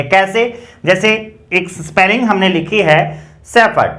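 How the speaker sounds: normal talking voice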